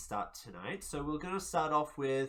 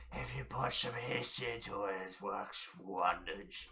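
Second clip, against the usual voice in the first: hissing reptile growl; 7 semitones lower, 7 dB quieter than usual. Retold or imitated. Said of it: "a really reptilian voice".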